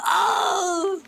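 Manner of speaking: sudden high oh; startled cry of surprise; surprised high shout. Real, not acted. Sudden exclamation.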